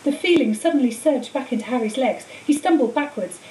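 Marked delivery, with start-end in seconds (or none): none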